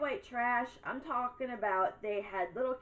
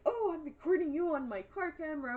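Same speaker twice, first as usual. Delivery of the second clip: higher, taunting imitation of the neighbor; mocking neighbor voice; 7 semitones higher than usual. Mocking the neighbor's threat to film her, higher taunting voice. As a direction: mimic her smug threat, pitched up. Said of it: "And she's like".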